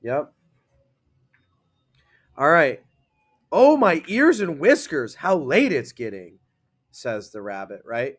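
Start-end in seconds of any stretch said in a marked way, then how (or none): 3.5-6.4 louder, higher, bouncing "Oh, my ears"; excited statement. Shown as a demonstration. Teacher showing class an excited line, excited. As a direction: sound thrilled and startled. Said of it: "You read it like that because it's excite".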